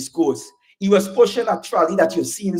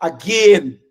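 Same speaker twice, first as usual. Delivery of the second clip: normal talking voice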